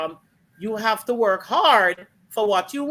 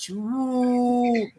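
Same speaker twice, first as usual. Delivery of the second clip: a hint of a spooky ghost moaning woo; 2 semitones higher than usual.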